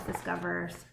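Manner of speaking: normal talking voice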